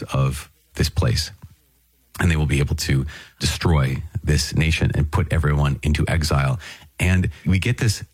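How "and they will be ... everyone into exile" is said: ordinary talk, nothing marked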